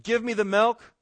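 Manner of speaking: high, loud demand. Shown as a demonstration. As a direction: order it with force. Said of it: "you come and you demand".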